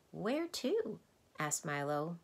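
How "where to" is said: put-on high, light boy's question; curious boy. A narrator's reading. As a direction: ask as a curious child. Boy Milo asks a question.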